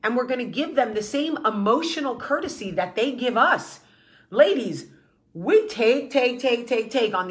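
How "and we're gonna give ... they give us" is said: usual speaking voice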